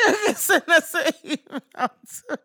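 talking through helpless laughter; words squeezed out while cracking up; laughing. Real, not acted. Laughing fit mid-sentence.

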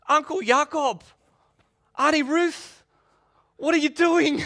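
loud, high-pitched mock disbelief; shocked calling out. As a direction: call out to relatives in hammed-up protest. Jesus calling to relatives pushing him.

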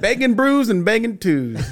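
high, delighted repeat of the slogan; excited slogan. Pretend. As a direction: sing it out high, grinning. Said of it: "What did I say?".

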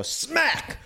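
high shouted "Smack!" instead of a real hit. Acted. Teller only saying the slap to the old woman, shouted smack. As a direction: yell the word like a slap. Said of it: "I say that".

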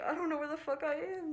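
high-pitched retold panic; freaking out. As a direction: act out being lost and freaking out. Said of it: "so I'm freaking out".

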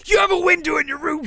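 high, loud, fast accusing shout. Retold or imitated. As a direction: yell it as the furious teacher. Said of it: "she said".

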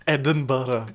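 slow mock-Scottish accent. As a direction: savour it in a put-on Scottish accent. Trading Scottish accent impressions.

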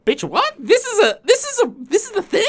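high-pitched outraged disbelief; shocked disbelief. Retold or imitated. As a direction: squeak it out in total disbelief. Shocked reaction retold.

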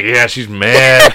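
loud, long-drawn gloating; retold taunt. Dad retelling his look out the window, drawn-out taunt. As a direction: stretch out "mad" as a taunt.

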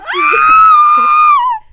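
very high, loud, long shriek; piercing acted-out shriek; reenacted horrified scream. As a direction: scream in mock disgust. Reenacts screaming as niece grabs poop.

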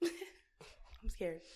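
ordinary delivery

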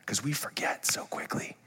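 whispered aside. Real, not acted. Preacher confiding to congregation in a whisper. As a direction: whisper it to the room.

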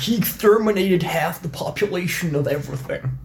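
grave commanding briefing, high and soft; nerd voice. Acted. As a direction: put on a nerdy dweeb voice. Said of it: "that was my general dweeb voice".

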